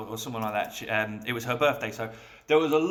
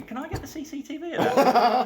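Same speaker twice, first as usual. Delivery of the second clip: laughing cheeky ask; 11 semitones higher, 4 dB louder than usual. Retold or imitated. Asking boss for footage.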